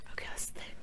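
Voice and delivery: breathy whisper to the class. Acted. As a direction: whisper it like a secret.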